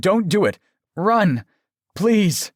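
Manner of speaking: high, loud, broken-up warning; urgent pleading. A narrator's reading. Aaron warning the girl at gunpoint, desperate. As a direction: urge her to flee, crying each phrase. Aaron urges her to flee.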